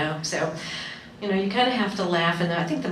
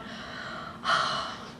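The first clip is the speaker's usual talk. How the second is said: weary sigh; 7 dB quieter than usual. Retold or imitated. Mother's weary reaction.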